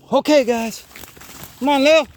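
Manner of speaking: high, loud call to the dogs. Real, not acted. Trainer calls his dogs forward, loud and high. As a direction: call the dogs on through the brush.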